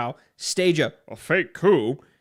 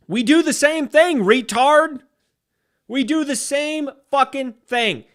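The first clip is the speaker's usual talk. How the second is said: angry shout; 10 semitones higher, 7 dB louder than usual. Real, not acted. Angry rant at critics.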